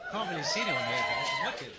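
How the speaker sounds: high excited laughing burst; laughing excitement. Real, not acted. Caller laughing excitedly to the studio audience. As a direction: squeal it out with delight.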